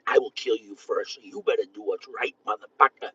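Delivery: low, softened warning; retold threat. Man retelling his warning to somebody he knew, low threat. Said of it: "I'd tell them before the shit hits".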